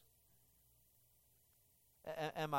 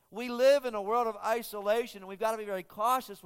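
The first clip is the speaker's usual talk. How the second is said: raised, louder preaching voice, higher and driving; preaching at full force; 5 semitones higher, 5 dB louder than usual. Acted. Pastor warning the church about isolation, preaching loud and high. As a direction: press the point home to the congregation.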